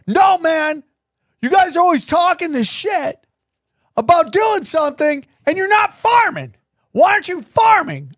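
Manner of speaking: high, insistent heckler voice; imitating an angry heckler; aggressive heckler. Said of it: "it starts to get really aggressive".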